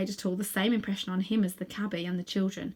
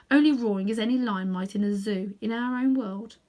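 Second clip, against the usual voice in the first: usual speaking voice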